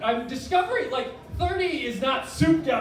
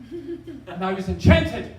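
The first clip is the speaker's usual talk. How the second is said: usual speaking voice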